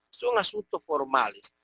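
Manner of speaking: ordinary talk, nothing marked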